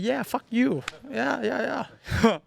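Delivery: high, sneering mimic of a rival; mock him like a smug taunter. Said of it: "Like this, bro".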